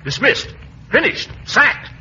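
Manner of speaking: high, emphatic burst, climbing word by word; angry firing. Acted. Gildersleeve sacking Llewellyn on the spot, angry and high. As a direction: fire him with mounting indignation. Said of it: "such an excitable fellow".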